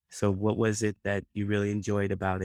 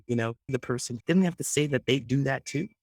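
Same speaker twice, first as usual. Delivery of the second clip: ordinary delivery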